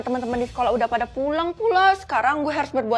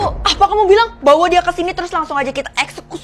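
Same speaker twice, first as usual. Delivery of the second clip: normal talking voice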